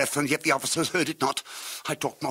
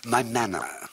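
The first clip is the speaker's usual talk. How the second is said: ordinary delivery, nothing marked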